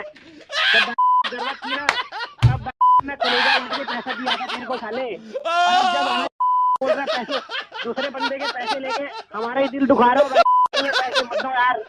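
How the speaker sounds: laughing rant. Acted. Mock emotional rant, laughing.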